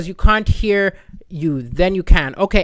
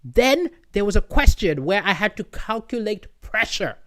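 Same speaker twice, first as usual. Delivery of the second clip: ordinary delivery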